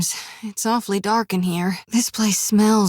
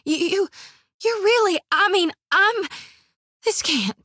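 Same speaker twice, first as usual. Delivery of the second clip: high, halting, tripping over her words; overwhelmed fan meeting her idol; stunned squeal; 6 semitones higher than usual. Acted. Shodi face to face with Gon, stammering in high awe. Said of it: "Shodi squeals. Trembling".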